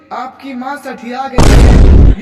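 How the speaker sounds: wife loudly complains to husband about his mother; loud complaint. Acted.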